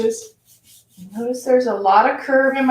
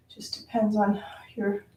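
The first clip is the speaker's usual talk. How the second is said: soft whispered aside; 10 dB quieter than usual. Real, not acted. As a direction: drop to a whisper. Aside mid-instruction.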